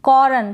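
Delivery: कॉर्न as kaw-run, two syllables; over-pronounce the R in कॉर्न; कॉर्न with a vowel after a full R; wrong full R. Shown as a demonstration. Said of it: "तो ये गलत हो जाएगा".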